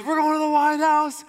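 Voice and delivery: imitating a pumped-up candidate; Howard Dean rally cry. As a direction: fire up the crowd like a candidate. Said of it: "'Cause he goes".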